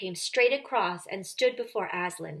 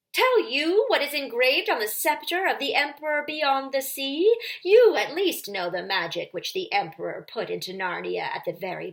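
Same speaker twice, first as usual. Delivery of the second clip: higher, louder witch claiming her right; shrill witch building; 4 semitones higher, 5 dB louder than usual. A narrator's reading. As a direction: climb to fury as she lists the laws. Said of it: "voice growing suddenly shriller".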